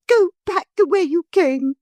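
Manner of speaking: high, loud furious take of "Go back the way you came"; crying out. A narrator's reading. Motor lady furiously crying out to the hippogriff. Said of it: "she cried".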